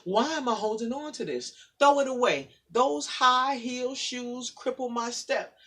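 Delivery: rhythmic spoken word, building in force; poem recital. Acted. Guest reading her poem to the audience, building spoken word.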